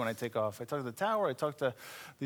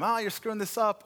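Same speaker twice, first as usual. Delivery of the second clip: higher mock instructor yell; 7 semitones higher than usual. Flight instructor yelling at a student pilot, mock yell. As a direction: imitate the instructor barking at you. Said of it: "he would just yell at you the whole time".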